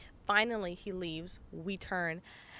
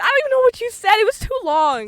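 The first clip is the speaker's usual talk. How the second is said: exasperated squeal; 13 semitones higher, 13 dB louder than usual. Real, not acted. Exasperated at walking distance.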